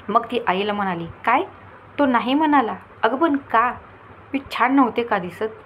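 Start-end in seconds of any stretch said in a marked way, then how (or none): 1.2-3.7 she can't believe he said no; shocked disbelief. Acted. Daughter shocked by the refusal. 4.3-5.5 hurt question. Acted. Daughter asks mother, hurt.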